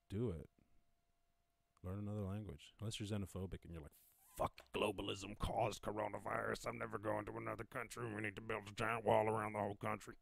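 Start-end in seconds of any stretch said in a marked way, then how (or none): 4.3-10.1 put-on monotone ranter's voice; mock a xenophobe's rant. Xenophobe refusing to go to another country, mocking voice. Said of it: "any fans who talk like this".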